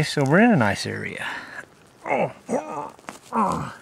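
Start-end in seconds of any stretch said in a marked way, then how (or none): 2.1-3.7 high strained grunts; effort grunt. Real, not acted. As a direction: groan as you pull hard. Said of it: "Sorry about the grunt and groaning".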